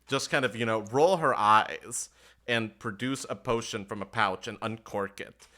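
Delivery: usual speaking voice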